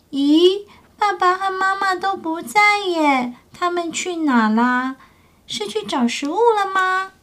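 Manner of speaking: high, small voice of a little pterosaur looking around; a baby dinosaur wondering where its parents went. Acted. Baby pterosaur wakes to find parents gone, puzzled.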